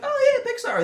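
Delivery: bright, high, breezy mock cheer; mock cheerful. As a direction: play it innocently upbeat. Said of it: "the movies are like".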